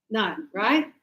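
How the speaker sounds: normal talking voice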